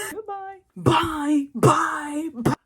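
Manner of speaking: high, loud mock farewell; shouted dismissal. Retelling a brush-off of a bossy guy, shouted dismissal. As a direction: yell them out the door, hammed-up. Telling off a would-be boss.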